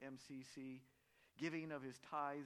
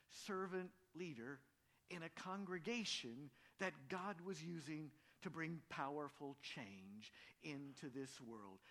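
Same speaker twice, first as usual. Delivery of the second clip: normal talking voice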